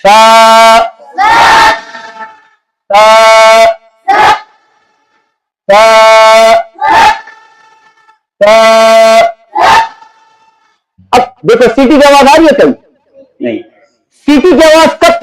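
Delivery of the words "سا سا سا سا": سا said with the tongue between the teeth, a lisped th-like sound, held. Shown as a demonstration. Teacher demonstrates the tongue-out sa for the pupil. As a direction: push the tongue out and hold سا. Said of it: "زبان کو باہر نکالنا ہے".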